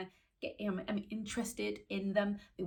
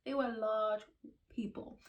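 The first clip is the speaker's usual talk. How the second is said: normal talking voice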